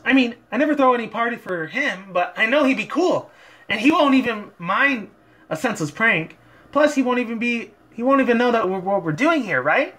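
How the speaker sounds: high, bouncy, excited pony voice; bubbly party pony bursting with energy; hyped-up Pinkie Pie. Acted. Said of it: "Pinkie Pie tried to bring a lot of her, like, hypeness".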